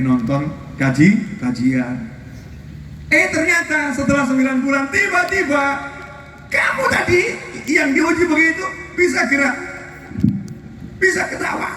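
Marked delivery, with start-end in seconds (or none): 3.1-11.7 high-pitched, excited preaching. Real, not acted. Preacher telling listeners of a sudden recovery, excited preaching. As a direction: build the surprise turn in a raised voice.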